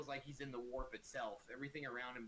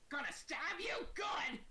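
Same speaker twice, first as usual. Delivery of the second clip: high, loud, slow goblin shout; goblin battle cry; 5 dB louder, slower than usual. Acted. Goblin with raised daggers threatening his target, high goblin shout. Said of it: "prepare and goes".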